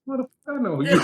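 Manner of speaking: mock exasperated, half-laughing; laughing inner thought. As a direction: say it as if thinking it, laughing. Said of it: "in my head, I'm like".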